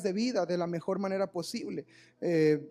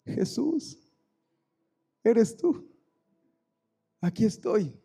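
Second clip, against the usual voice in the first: much higher, hushed wonder turning louder; awed grandmother; 4 semitones higher, 5 dB louder, much slower than usual. Retold or imitated. Grandmother wakes and sees a light, awed. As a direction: greet the light in awe. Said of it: "ella ve la luz así".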